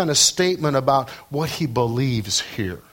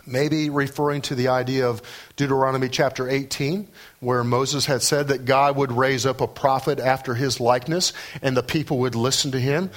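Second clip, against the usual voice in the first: ordinary talk, nothing marked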